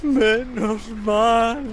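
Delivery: drawn-out sigh of relief; relieved sigh. Acted. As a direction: let it out as huge relief. Madman relieved.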